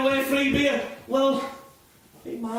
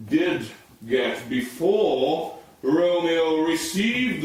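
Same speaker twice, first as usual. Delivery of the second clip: a hint of a lower, slow, prim schoolteacher; English teacher; 5 semitones lower, slower than usual. Teacher explaining Romeo and Juliet to the class, mock-pompous. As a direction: lecture the class on the play, lightly mock-pompous. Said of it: "as an English teacher".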